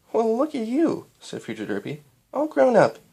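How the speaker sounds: lightly voiced proud mom; proud mother. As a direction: beam at the grown daughter. Voices Future Derpy.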